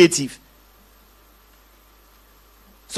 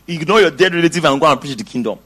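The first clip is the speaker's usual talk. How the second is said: usual speaking voice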